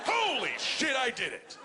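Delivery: a very high, disbelieving cry of victory; stunned triumph. Retold or imitated. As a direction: gasp it out like you can't believe you won. Pitcher after the no-hitter.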